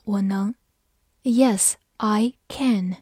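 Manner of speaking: ordinary talk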